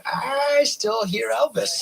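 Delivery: high, drawn-out mock protest; mock complaint. Viewer reacting to actor's lingering accent, high mock protest. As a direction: stretch it out high, teasing. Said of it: "and I was like".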